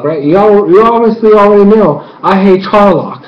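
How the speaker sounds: usual speaking voice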